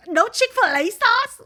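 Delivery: high, drawn-out mock scream; hammed-up crazy outburst, very loud; over-the-top freak-out. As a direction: shriek it like an absurd meltdown. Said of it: "overly the top".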